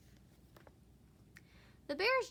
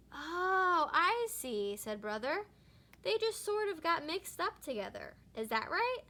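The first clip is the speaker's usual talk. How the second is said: cub voice realizing; 5 semitones higher, 3 dB louder than usual. A narrator's reading. Brother realizing in Bible story.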